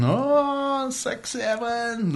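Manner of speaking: high, very slow drawn-out six seven; mock teenager. Imitating students' six seven in class, high and slow. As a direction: drag out six seven like a bored kid. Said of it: "in the classes going".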